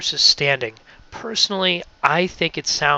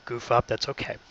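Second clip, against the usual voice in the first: ordinary delivery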